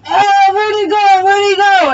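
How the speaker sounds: high-pitched mock-frantic parent wail; hammed-up, high whiny alarm; mock panic. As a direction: imitate a parent panicking over a lost child. Frantic parent of kidnapped child.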